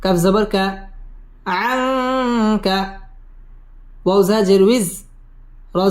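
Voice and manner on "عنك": "عنك" with a long nasal hum before the k; "عنك" drawn out on a nasalised n; ikhfa ghunnah. Shown as a demonstration. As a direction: hold the n of "عنك" through the nose, very slowly. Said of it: "আং ইকফা গুন্নাহ করে পড়তে হবে".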